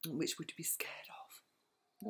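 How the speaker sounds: hushed, teasing scary whisper; spooky whisper. Acted. Nana whispering a spooky question to the grandchild. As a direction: whisper it to give a little shiver.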